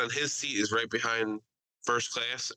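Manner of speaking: normal talking voice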